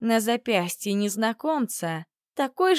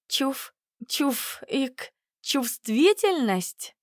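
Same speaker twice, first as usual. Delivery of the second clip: stammering in fright; 4 semitones higher, much slower than usual. A narrator's reading. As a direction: stammer out the word in shock. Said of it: "Я не заика, но тут ситуация располагает".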